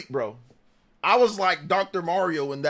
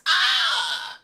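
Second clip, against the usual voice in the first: mock coach scream. Streamer imitates coach yelling at the defense, high scream. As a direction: shriek it in the whiny coach voice. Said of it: "we need to yell at the defense".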